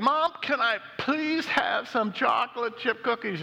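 put-on high-pitched little kid hollering; hammed-up child's yell; child letting loose. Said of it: "the three-year-old lets go again".

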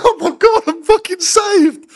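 high, loud burst of relief; relieved cry. Retold or imitated. Kidnapped man hearing help at the door, high relieved outburst. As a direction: squeal it like rescue just arrived. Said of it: "I'm going".

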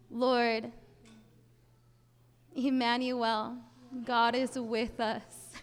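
slow, hushed opening of a prayer. Acted. Speaker leading the audience in prayer, reverent. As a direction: pray with reverent pauses.

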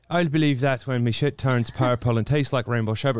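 put-on Irish accent. Shown as a demonstration. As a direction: switch into an Irish brogue to show it off. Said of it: "I am Irish, so I'd be like".

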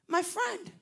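bright hail of a friend; glad greeting. Retold or imitated. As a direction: call out to a friend. Man greets him warmly.

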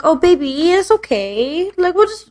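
high-pitched coo; mock soothing. Owner showing the PlayStation love, playfully soothing. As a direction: coo to it as if comforting a baby.

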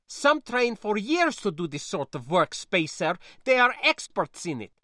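put-on high, loud accented niece, lecturing; fuming niece. Niece warning spacer about real battles, fuming. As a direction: scold with urgent concern in a foreign accent.

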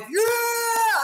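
high, drawn-out cheering shout; crowd cheer. Acted. Village hearing Aether yell out, high cheering shout. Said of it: "they're like".

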